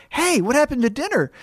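high, cheerful innocent question; lightly high friendly voice; mock innocent. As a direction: sound clueless and sweet. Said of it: "she's like".

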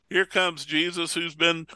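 usual speaking voice

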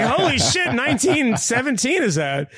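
high, laughing fake thrill; laughing mock excitement. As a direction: gush through a laugh about getting what nobody asked for. Mock delight at unwanted movie.

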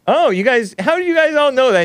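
high, fast, astonished; amazed. Real, not acted. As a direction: squeal at the chat's knowledge. Surprised the chat knows.

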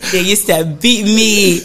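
slow words through laughter; laughing. Real, not acted. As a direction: laugh it out, slowly. Laughing while recalling school beatings.

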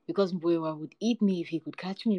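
usual speaking voice

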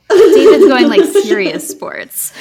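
words pushed out through loud laughter; can't stop laughing while talking; laughing hard. Real, not acted. Cracking up at a colleague's answer, laughing loud.

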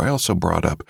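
ordinary talk, nothing marked

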